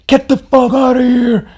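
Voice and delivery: very high mock yell; high, strained shout, acted out; angry man. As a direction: imitate an enraged man chasing someone off. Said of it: "And he's so angry".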